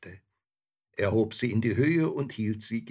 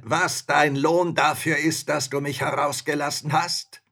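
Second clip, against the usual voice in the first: loud, raised, menacing giant; terrible spirit voice; 5 semitones higher, 8 dB louder than usual. A narrator's reading. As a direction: threaten loudly as a dreadful spirit. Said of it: "rief er mit einer fürchterlichen Stimme".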